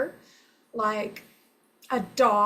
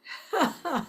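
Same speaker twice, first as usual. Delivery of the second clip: light laugh; 4 semitones higher, 4 dB quieter than usual. Real, not acted. Laughs after bird call.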